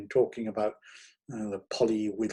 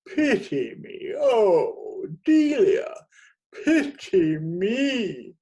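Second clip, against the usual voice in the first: very high, loud, slow melodramatic wail; pathetic plea; 9 semitones higher, 7 dB louder, much slower than usual. A narrator's reading. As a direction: beg like a tragedian. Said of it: "lapsing into the pathetic".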